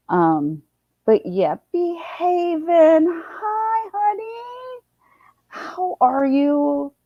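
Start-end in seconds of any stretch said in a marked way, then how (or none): 1.7-4.8 high, slow, drawn-out cooing hello; sweet greeting. Real, not acted. Greeting viewers joining the live. 5.5-6.9 high, very slow, sing-song how are you; cooing greeting. Real, not acted. Repeated greeting to viewers.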